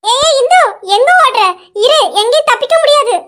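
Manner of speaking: high, loud, laughing chase call; playful teasing. Acted. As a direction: tease her loudly as you chase, laughter breaking in. Teasing friend chases the shy girl. (விளையாட்டாக உரத்த கேலி)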